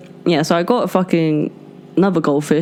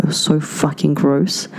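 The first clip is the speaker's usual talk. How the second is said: ordinary talk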